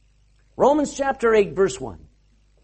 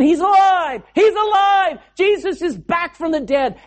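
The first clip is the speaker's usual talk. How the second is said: raised, higher cries of astonished joy; excited proclamation; 6 semitones higher, 3 dB louder than usual. Retold or imitated. Disciples spreading news of the risen Jesus, excited cries. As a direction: call out breaking news to everyone. Acting people announcing the resurrection.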